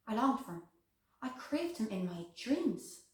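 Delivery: soft, intimate confession; hushed longing. Acted. Woman confessing her craving for another man, hushed yearning. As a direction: confide the longing quietly.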